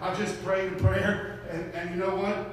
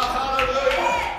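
shouted hallelujah; 5 semitones higher, 7 dB louder, slower than usual. Retold or imitated. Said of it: "I just shouted a while".

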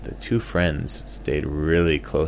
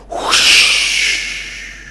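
sudden whoosh; 9 dB louder than usual. A narrator's reading. Sudden sound effect.

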